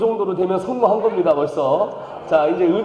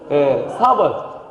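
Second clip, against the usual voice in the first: ordinary delivery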